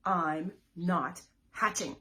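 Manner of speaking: loud stubborn egg; loud stubborn refusal. Acted. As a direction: plant your feet and refuse. Refrain, louder.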